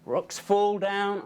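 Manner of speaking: ordinary delivery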